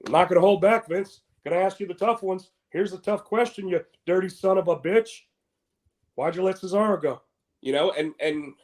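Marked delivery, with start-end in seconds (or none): 0.1-7.2 higher, punchy tough-guy interviewer, lightly voiced; pretend Steve Austin. Imagined Austin grilling Vince, in a lightly voiced tough-guy bark. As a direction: square up and fire the hard question at him. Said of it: "you would picture Steve Austin being like".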